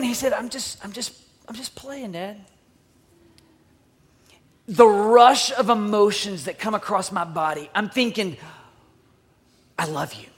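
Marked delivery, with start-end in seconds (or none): 0.3-2.4 soft, hesitant little answer; meek child. Retold or imitated. Son found playing answers his dad, meekly. 9.8-10.3 low, soft, heartfelt; tender. Retold or imitated. Relieved dad thinking of his found son, tenderly.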